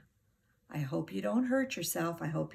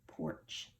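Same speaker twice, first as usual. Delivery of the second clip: soft blended word; 7 dB quieter than usual. Shown as a demonstration. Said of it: "mustn't forget this digraph".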